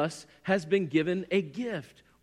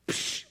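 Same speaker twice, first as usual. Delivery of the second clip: long, airy "psh"; imitated hushing noise; 5 dB quieter than usual. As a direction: cut off the complaint with a shush. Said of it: "the Holy Spirit was like".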